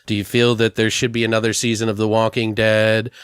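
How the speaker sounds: ordinary talk, nothing marked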